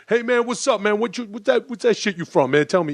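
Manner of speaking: high, pestering fan voice; pushy fan. Retold or imitated. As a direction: rush up and accost a celebrity. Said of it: "run up to someone and go".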